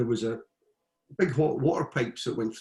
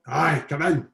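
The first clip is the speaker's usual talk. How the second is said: shouting; 4 semitones higher, 5 dB louder than usual. Retold or imitated. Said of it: "So he shouts".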